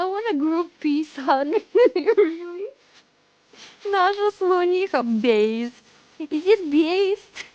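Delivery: put-on Russian accent. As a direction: play it big with a Russian accent. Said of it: "I have to do it with a Russian accent".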